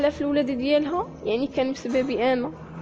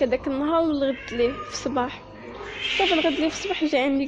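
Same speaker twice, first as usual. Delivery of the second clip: ordinary talk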